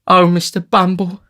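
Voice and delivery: high, loud cry of distress; distressed lady. A narrator's reading. Matron appeals to the beadle, distressed high cry. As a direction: cry out to him in a high lady's voice. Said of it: "cried the lady".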